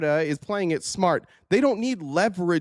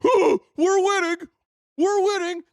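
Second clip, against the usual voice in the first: mocking gloat; 14 semitones higher, 4 dB louder than usual. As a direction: parrot their victory cry as a silly whine. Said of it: "you dumb dicks are sitting here going".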